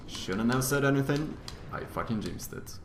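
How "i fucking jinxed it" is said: quiet wary murmur. Real, not acted. Wary of enemies, speaking low.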